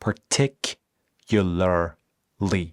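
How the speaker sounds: Particularly drawn out syllable by syllable; slow model word. Shown as a demonstration. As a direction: say Particularly very slowly for students to repeat. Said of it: "اینو بعد از من تکرار بکنید".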